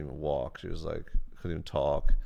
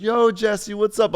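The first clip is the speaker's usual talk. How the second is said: much higher, louder eager fan; excited fan greeting; 13 semitones higher, 8 dB louder, slower than usual. Retold or imitated. Viewers spotting him at the movies, loud high greeting. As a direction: hype fan calling out.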